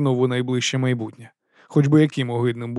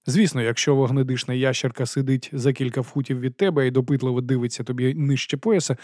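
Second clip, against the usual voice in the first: ordinary delivery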